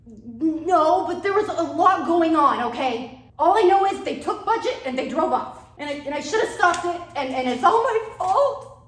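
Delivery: high, loud frantic babbling; panicking. Acted. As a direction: spill it all out in a panic. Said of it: "Dude, you're panicking".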